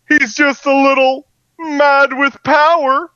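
high, slow, mock-sinister villain line; cartoon-crazy gloating voice; mad with power. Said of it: "I say it like that because that's the joke".

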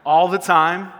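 leading the church's shouted answer; loud response. Shown as a demonstration. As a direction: answer "All the time" loudly, rising on "all". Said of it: "let's try it together".

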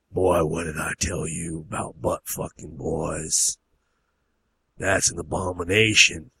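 slow, low stern father voice; low, drawn-out disapproval; stern backwoods father. Acted. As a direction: scold the boy slowly and heavily. Father confronts son in story.

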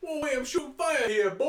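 loud, high cartoon jock; idiot jock. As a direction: blurt it like a show-off meathead. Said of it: "He sounded like such an idiot".

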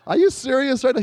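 high-pitched retold disbelief; incredulous. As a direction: ask it way up high, mock-astonished. Retelling disbelief at mother's suggestion.

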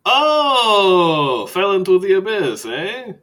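high, loud, theatrical vampire greeting; Skurlock the vampire. Acted. Skurlock voice continues.

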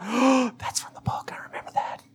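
hushed, breath-only fan whisper; excited whisper. Retold or imitated. Book fan spotting a detail in the movie, excited whisper. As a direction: whisper it in delighted recognition.

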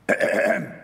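pointed, staged throat clearing. Acted. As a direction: clear your throat to get their attention. Angels interrupting the gazing disciples.

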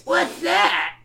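put-on very high, babyish kid question; cartoon goblin-boy astonishment; exclaiming. A narrator's reading. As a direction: squeak it as an amazed little brother. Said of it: "Stinko exclaims".

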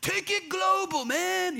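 high, laid-back bro voice; hyped-up dude pitching a road trip; surfer dude. Retold or imitated. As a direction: play it like a chill surfer bro. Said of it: "they took off".

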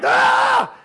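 high, loud battle yell; war cry. Acted. Teacher acting out war against problems, war cry. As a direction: charge screaming into the fight.

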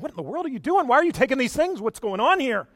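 high-pitched, incredulous questioning; acted indignant disbelief. Priest confronting men taking the idols, bewildered protest. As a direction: fire off questions in mock alarm.

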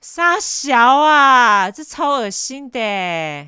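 high, loud, very slow disgust; appalled groaning cry; disgusted drawl. Real, not acted. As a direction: stretch it out in disbelief. Disgusted reaction.

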